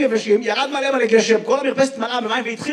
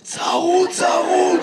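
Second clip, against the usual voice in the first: drawn-out, higher-pitched hoarse mimicry; 5 semitones higher, much slower than usual. Comic mimics a hoarse audience member, hoarse mimicry.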